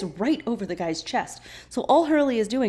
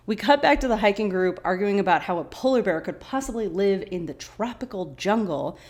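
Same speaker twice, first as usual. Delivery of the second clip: ordinary talk, nothing marked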